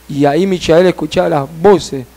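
usual speaking voice

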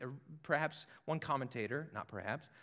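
normal talking voice

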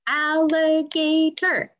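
slow, each syllable separated. Shown as a demonstration. Teacher to kids, counting syllables, slow and spaced. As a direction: clap out al-li-ga-tor slowly. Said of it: "how many sounds an alligator would have".

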